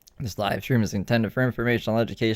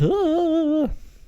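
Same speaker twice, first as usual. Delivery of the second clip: high laugh; 3 dB louder than usual. Real, not acted. Laughs at own breathlessness.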